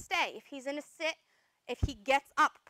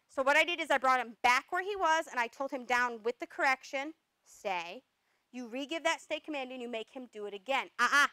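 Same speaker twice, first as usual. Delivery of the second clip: normal talking voice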